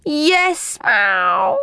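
talking cat with a drawn-out meow. A narrator's reading. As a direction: answer as a cat, sliding into a meow. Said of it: "meowed Ginger".

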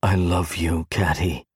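low, subdued declaration of love; understated lump-in-the-throat tenderness; choked-up. A narrator's reading. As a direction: hold back emotion, speak low. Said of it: "he could barely speak".

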